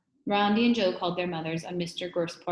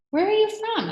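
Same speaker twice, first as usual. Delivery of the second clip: put-on high child question; 8 dB louder than usual. A narrator's reading. Child asks suspicious question.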